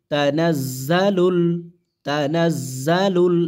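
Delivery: তানাজ্জালুল drawn out slowly with the doubled জ held; slow model pronunciation. Shown as a demonstration. Teacher demonstrates তানাজ্জালুল for the students to repeat. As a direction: sound out তানাজ্জালুল slowly, holding the জ. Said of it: "এইভাবে, হ্যাঁ?".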